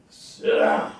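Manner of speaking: very low, loud stern order; preacher's command. Retold or imitated. Tobias ordering Garrick to sit, low stern command. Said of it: "Channing Tobias is a preacher".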